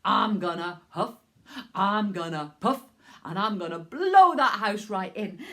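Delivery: low, loud wolf threat building on blow; big bad wolf. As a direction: growl it out like a hungry wolf.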